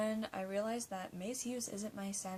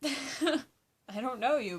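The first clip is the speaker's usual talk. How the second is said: laughing; 5 semitones higher, 6 dB louder, much slower than usual. Real, not acted. Laughing while answering.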